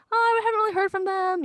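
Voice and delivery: high, loud, flustered denial; awkward fake surprise. To Chandler about Phoebe, a high flustered fib. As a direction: squeak out an innocent lie. Lying to Chandler, caught off guard.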